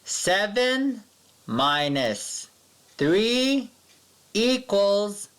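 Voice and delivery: high, spaced words with a pause between each; read-along equation. Shown as a demonstration. Teacher reads the subtraction with the class, word by word. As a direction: lead the class through it word by word. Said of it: "Let's read it together".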